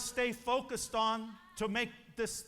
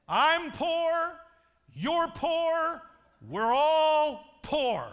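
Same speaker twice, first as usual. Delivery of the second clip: loud, slow, higher mock tirade, pounding each poor; mocking bitter shout; 6 semitones higher, 11 dB louder, much slower than usual. Preacher voicing jealous friends, loud mock shout. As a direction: bellow it like a bitter crab holding the others down.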